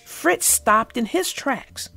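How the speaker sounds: gentle read-aloud for children, voicing each animal; story narration. A narrator's reading. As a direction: tell it like a bedtime storybook.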